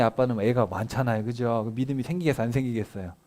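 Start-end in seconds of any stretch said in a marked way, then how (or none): none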